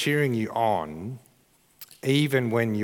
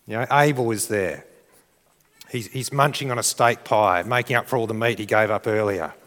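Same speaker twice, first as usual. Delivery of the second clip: ordinary talk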